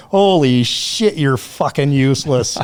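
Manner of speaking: usual speaking voice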